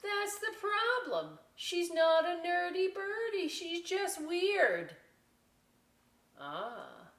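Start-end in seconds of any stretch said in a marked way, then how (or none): 0.0-5.0 high, loud sneering; scornful nerdy birds. Acted. Nerdy birds writing the vulture off, high and loud. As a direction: dismiss her with a sniff.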